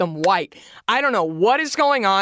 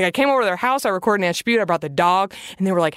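ordinary delivery

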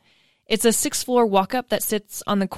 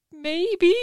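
sheepish high voice; 9 semitones higher than usual. Retold or imitated. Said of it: "I was like".

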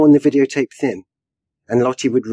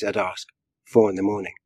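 plain reading voice

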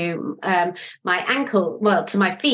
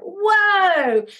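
startled whoa; 11 semitones higher than usual. Retold or imitated. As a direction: recoil in shock, pitch jumping up on "Whoa". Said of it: "like that".

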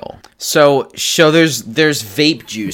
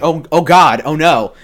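mock panic; 5 semitones higher, 4 dB louder than usual. Violet panics as she swells.

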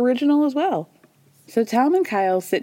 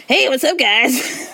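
impression of her ex; 5 semitones higher, slower than usual. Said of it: "she does the impression of him".

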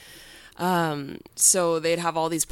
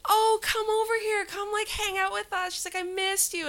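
much higher, sugary mock girly voice; fake sweet girl. The girl calling her mean boyfriend over, mock sugary and high. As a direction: imitate her sudden syrupy sweetness.

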